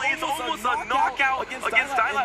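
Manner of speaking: high, urgent match commentary; excited play-by-play. Real, not acted. Caster calling a near knockout against Dialab, excited. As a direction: call a huge momentum swing as it happens. Live play-by-play of big swing.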